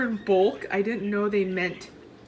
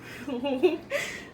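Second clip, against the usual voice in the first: breathy burst of laughter; 5 semitones higher than usual. Real, not acted. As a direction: laugh in disbelief at it. Reacting to costly wood purchase.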